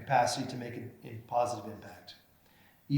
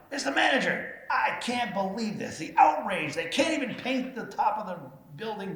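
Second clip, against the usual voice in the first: higher, hammed-up whiny caller voice; mock outraged complainer; 8 semitones higher than usual. The speaker mocking a complaining caller to the manager, high and whiny. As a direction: play the outraged caller, pitched way up.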